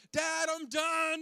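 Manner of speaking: put-on high, loud, slow toddler call from the bathroom; imitating toddler yell. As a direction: call out like a proud little kid. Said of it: "then he'd yell".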